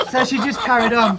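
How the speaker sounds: words pushed out through laughter; loud laughing delivery. Real, not acted. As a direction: keep talking while you crack up.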